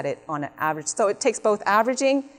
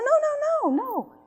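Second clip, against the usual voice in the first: mock protest. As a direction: play a driver objecting, voice shooting way up. Acts driver protesting to cop.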